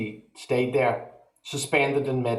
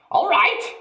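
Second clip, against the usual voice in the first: put-on very high, loud outraged old woman; indignant yell; 5 dB louder than usual. A narrator's reading. Grandma outraged at George, very high and loud. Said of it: "she yelled".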